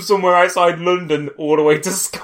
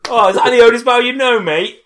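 higher and louder jeer; louder mock jeer; mocking taunt; 6 semitones higher, 7 dB louder than usual. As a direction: tease him. Mocking Harry's single spell.

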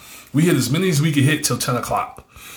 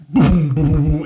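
booming beat noises, pitched up; imitated bass boom; 4 semitones higher, 3 dB louder than usual. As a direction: mimic the thump of loud music. Imitates loud music in story.

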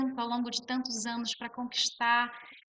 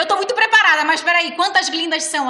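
much higher, much louder, thrilled; excited disbelief; 7 semitones higher, 15 dB louder than usual. Retold or imitated. Answering the producer on the phone, squealing with excited disbelief. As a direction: squeal it out in happy shock. Hearing she got the role.

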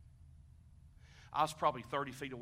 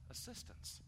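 whispered; 7 semitones higher, 13 dB quieter than usual. Acted. Repeats word, echo whisper.

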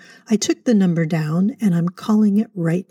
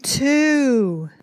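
yelling; 7 semitones higher than usual. Acted. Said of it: "Don't yell".

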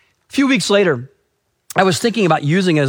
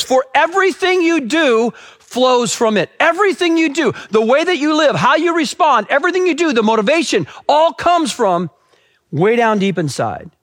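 high-pitched, insistent proclamation; urgent, raised preaching voice; emphatic preaching; 9 semitones higher than usual. Acted. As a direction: drive each phrase home, pitched high. Preacher driving the verse home.

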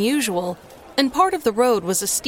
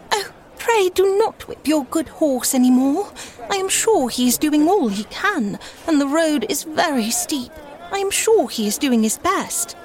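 higher, pleading gentle lady; sweet, earnest; 6 semitones higher than usual. A narrator's reading. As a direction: earnestly beg him to stop. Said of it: "said in a sweet, earnest voice".

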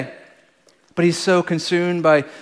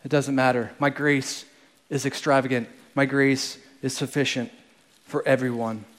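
tender Father; 5 semitones lower, 9 dB quieter than usual. Retold or imitated. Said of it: "But the Father is saying".

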